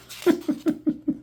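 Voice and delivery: real airy giggle; high breathy laugh. Real, not acted. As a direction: burst of unvoiced laughter. Laughing at own joke.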